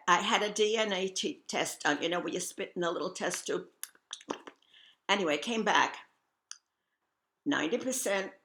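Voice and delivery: normal talking voice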